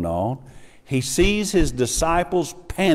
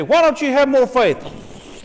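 high, louder, mock scolding; shrill mocking rebuke, higher and louder; harsh scolding God; 12 semitones higher, 4 dB louder than usual. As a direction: berate them like a harsh God. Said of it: "He didn't get up there and say".

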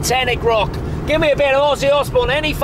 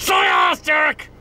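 raised-pitch, bullying command; angry demand; 3 semitones higher than usual. Acted. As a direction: insist sharply that they say it. Angry demand to repeat the word.

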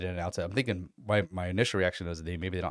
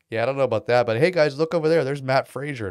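louder, calling out to the crowd to change the subject; loud deflection; 5 semitones higher, 10 dB louder than usual. Retold or imitated. Dave dodges a press question by calling out Matt Frazier, loud and higher. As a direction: shout it like you're pointing across a room.

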